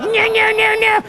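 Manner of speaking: high whining no; mock reluctant self; whiny refusal. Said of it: "I'm like".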